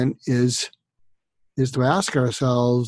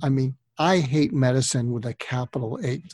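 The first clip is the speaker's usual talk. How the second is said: usual speaking voice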